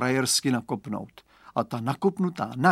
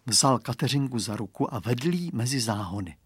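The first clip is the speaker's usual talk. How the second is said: ordinary delivery, nothing marked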